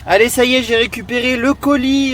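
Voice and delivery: high, loud, thrilled cry; excited shout. Real, not acted. Vlogger showing off a huge parcel to viewers, thrilled and loud. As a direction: whoop over the big parcel.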